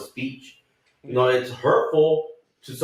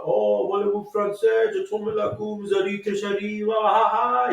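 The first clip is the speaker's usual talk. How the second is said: mock French jabber, pitched way up; old Frenchman ranting; 11 semitones higher than usual. Man imitating an old French neighbour's talk, high-pitched mock ranting. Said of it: "He'll, like, talk like that".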